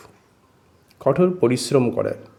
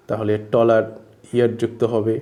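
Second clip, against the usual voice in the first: usual speaking voice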